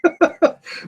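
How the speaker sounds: high sudden laugh; burst of laughter. Real, not acted. Host laughs at notes.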